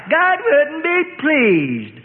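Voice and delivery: high, very slow prissy mimicry; mocking girl. A narrator's reading. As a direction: drag it out in a snooty taunt. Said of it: "mocked a wrinkled-nosed girl".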